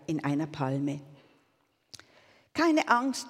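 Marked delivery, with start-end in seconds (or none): 2.5-3.3 high-pitched little bird voice; chirping sparrow. A narrator's reading. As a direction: chirp it out reassuringly. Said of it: "chilpte der Spatz".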